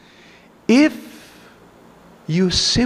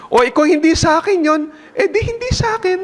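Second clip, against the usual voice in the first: mock shrug; 7 semitones higher, much faster than usual. High mock reply.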